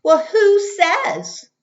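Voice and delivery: put-on slow, high and loud child's comeback; a sulky kid pushing back hard; defiant retort. A narrator's reading. Russell talks back in story.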